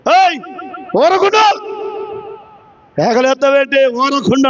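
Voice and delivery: silencing rebuke. Retold or imitated. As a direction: call out to silence someone. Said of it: "నోరుమీయమంటున్నాడు".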